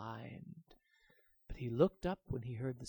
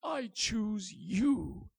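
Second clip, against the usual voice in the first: dragon voice; 6 semitones higher, 5 dB louder, much slower than usual. A narrator's reading. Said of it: "said the dragon".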